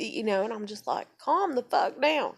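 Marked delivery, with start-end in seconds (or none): 1.2-2.4 loud, high, drawn-out retold order; imitating herself ordering him down, slow and loud; exasperated command. Telling panicking boyfriend on the phone, exasperated.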